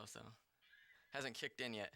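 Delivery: ordinary talk, nothing marked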